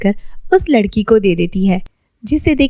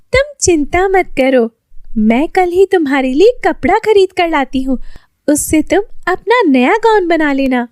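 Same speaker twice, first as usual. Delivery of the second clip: put-on higher, reassuring girlish voice; friendly girl voice; 8 semitones higher than usual. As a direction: play the kind friend offering help. Julie voiced by narrator.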